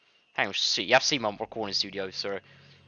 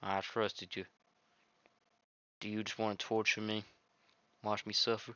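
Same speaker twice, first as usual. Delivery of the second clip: put-on quiet, wounded girl; softly read heartbroken heroine; 4 dB quieter than usual. The deleted heroine mourning to the player, soft and hurt. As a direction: let her plead quietly, hurt. Reads heroine's accusing lines.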